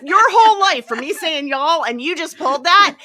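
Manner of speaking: high, loud indignant retort; gasp, then loudly accuse in mock offence; mock outrage. Oldest child finally says y'all after years of teasing, mock outrage.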